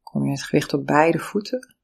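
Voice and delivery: normal talking voice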